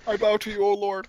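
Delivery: high loud hammed-up reverence; bow down like a zealot; mock worship.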